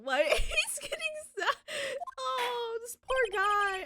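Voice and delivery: high, wailing fake sob; mock crying. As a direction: blubber about it like a kid. Mock weeping over sad character.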